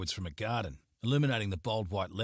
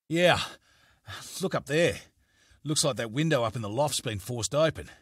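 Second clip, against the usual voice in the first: catching breath; 4 semitones higher, 4 dB louder than usual. Acted. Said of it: "still catching his breath".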